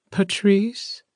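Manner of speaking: high, questioning character voice; Eileen's shrill voice. A narrator's reading. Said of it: "I heard Eileen ask".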